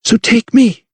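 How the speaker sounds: high, urgent plea; desperate offer, pitched well up; pleading. A narrator's reading. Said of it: "she pleaded".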